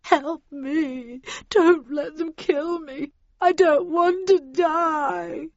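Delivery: high, slow, terrified pleading; whimpering. A narrator's reading. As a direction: beg for your life through tears. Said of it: "heard Heathcliff whimper".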